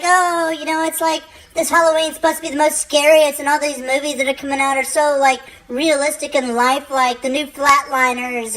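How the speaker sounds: high, gushing mock fan; excited fan. People hyping Halloween movies, high gushing mock. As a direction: gush over scary movies. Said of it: "they're like".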